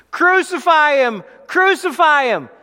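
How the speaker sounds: high, loud mob yell; crowd shouting. Acted. The crowd answering Pilate, shouted chant.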